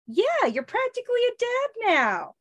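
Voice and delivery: high playful teasing; teasing girl voice. Acted. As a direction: tease him about being a dad. [name] teasing in comic read.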